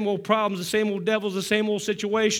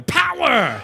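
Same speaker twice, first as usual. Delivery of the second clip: higher, louder shout of power; 6 semitones higher, 6 dB louder than usual. Shown as a demonstration. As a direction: belt it out to lift the room. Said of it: "I wanna hear power".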